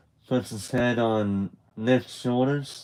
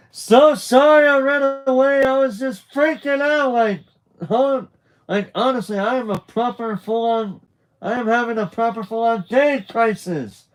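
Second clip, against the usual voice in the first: much higher, louder, panicked outburst; frantic yelling; 13 semitones higher, 8 dB louder than usual. Retold or imitated. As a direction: blurt it all out in a frantic high yell. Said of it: "starts yelling at the top of his lungs".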